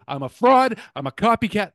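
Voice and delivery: hammed-up, loud mock confession; mock outburst. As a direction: shout it like a dramatic confession. Mock self-accusation, repeated louder.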